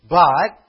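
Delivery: But hammered out loud and alone; strong adversative. Shown as a demonstration. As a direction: slam the word But down hard. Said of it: "another strong adversative".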